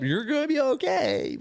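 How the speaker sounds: high, slow, drawn-out shout; hammed-up yell of encouragement; retold shouted reassurance. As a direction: loudly reassure someone who is panicking, stretching the words. Retelling shouted reassurance to injured friend.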